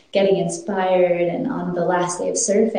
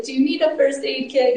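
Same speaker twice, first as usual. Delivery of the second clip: concerned beachgoer; 8 semitones higher than usual. Retold or imitated. Beachgoers offering help.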